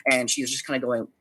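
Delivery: normal talking voice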